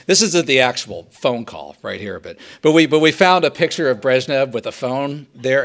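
normal talking voice

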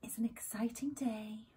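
hushed, secret whisper. Acted. Teasing a new haul.

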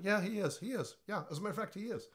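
high, casual offhand; mock third person. Shown as a demonstration. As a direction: toss it off like small talk. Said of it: "Don't do third person saying".

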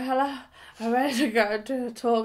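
words slurred round a painful tooth; talking with a sore mouth. Retold or imitated. Greeting her colleague with a sore mouth, slurred words. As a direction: speak as if the jaw hurts to move. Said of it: "I was talking like this 'cause of".

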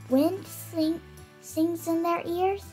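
usual speaking voice

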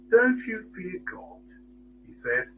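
ordinary delivery

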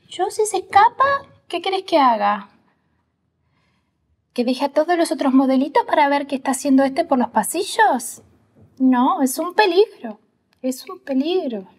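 high-pitched mock teacher protest; maestra quejándose. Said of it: "ella me dice". (imitación de maestra desbordada, con voz aguda)

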